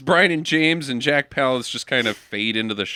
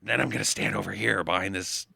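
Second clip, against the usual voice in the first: menacing villain; 8 semitones lower than usual. Retold or imitated. Mocking Jack Palance's lurking villain voice for friends.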